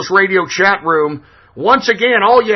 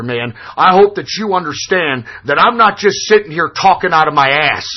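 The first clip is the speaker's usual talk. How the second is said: ordinary talk, nothing marked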